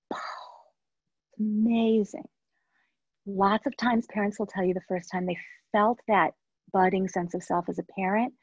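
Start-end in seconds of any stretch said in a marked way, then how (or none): 0.1-2.3 awed whisper. Retold or imitated. Recalling her awe as mother.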